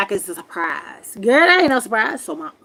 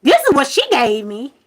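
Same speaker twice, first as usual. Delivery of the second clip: loud, very high outburst; retold angry shout; 12 semitones higher, 6 dB louder than usual. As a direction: throw it back at him. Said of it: "I said".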